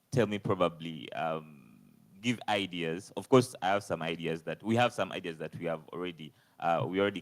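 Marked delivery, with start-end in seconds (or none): none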